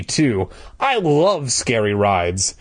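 ordinary delivery

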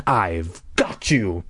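low, slow mock-scream without volume; scary monster voice. Masked sister tormenting her brother, scary low growl. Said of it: "she screamed, surprising herself how scary her voice sounded".

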